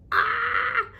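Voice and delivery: high-pitched short mock shriek; mock scream. Daughter reacting to dad's silence with a mock shriek. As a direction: let out a high, held "ah" of panic. Retold panicked reaction on phone.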